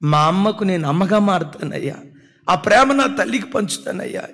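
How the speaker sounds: impassioned tearful prayer for mother; tearful pleading prayer. Retold or imitated. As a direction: beg God through tears, voice lifted high. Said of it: "ఏడుస్తూ అడుగుతానన్నా".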